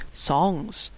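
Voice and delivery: softly scornful echo; said in sarcastic quote marks; mocking air quotes. A narrator's reading. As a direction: hold the word up as ridiculous. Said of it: "made big fake quotes with her fingers".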